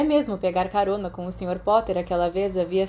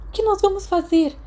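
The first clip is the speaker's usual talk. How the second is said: much higher, louder anxious cry; panicked; 12 semitones higher, 5 dB louder than usual. A narrator's reading. Peter panicking to the others at the locked door.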